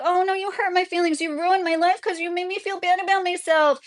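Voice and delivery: high, whining mock victim; mocking whiny complainer. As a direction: whine it like a wounded snowflake. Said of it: "I'm sorry to make that whiny voice".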